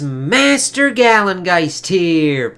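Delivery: high, loud, slow announcer greeting; hammed-up intro. Host greeting his viewers in a big, slow announcer voice. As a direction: stretch it out like a show host.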